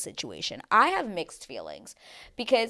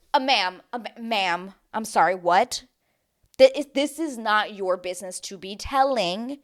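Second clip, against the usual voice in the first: mock indignation; 3 semitones higher, 6 dB louder than usual. Mock confronting a contestant.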